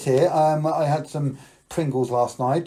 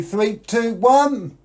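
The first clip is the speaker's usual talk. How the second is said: magic countdown; 8 semitones higher, 5 dB louder, much slower than usual. Acted. Countdown to the kids.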